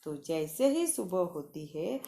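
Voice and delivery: usual speaking voice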